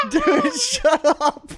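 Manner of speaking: very high, drawn-out squeal through laughter; hysterical laughing. Real, not acted. Storyteller begging a teasing friend to stop, hysterically laughing. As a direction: wail it slowly, laughing till you nearly cry.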